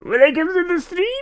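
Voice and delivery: very high put-on creepy voice; spooky host. Streamer tries out a scary stream welcome, high and hammy. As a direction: squeal the welcome like a horror host.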